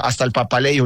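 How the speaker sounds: usual speaking voice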